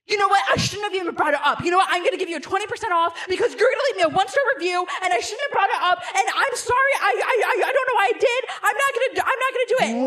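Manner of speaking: fast, high, loud panicked babble; freaking out. Retold or imitated. Owner panicking at the customers, fast and high. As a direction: gabble out a flustered apology. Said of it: "then he starts freaking out".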